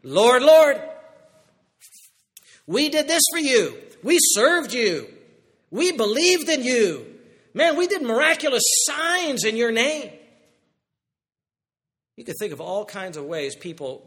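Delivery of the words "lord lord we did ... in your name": loud high pleading, slow in places; pleading resume. Retold or imitated. Sinners pleading their religious resume to Jesus, loud and high. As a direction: beg to get in by listing good deeds. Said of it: "did you hear the".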